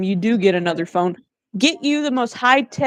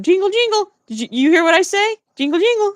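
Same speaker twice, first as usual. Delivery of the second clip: high-pitched playful attention-getter; jingling keys; 9 semitones higher than usual. Acted. Streamer mimicking keys for distractible followers, high playful jingling. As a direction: sing it out high, like keys dangled before a baby. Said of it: "I'm the keys jingling in their face".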